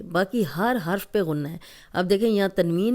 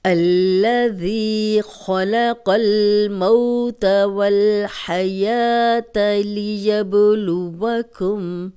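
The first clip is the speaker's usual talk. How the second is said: slow, louder melodic tajweed recitation; Quran recitation; 2 semitones higher, 4 dB louder, much slower than usual. Acted. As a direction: chant the verses slowly and fully. (آہستہ، بلند آواز میں ترتیل سے تلاوت)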